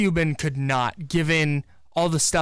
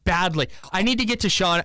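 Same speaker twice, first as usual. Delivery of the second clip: usual speaking voice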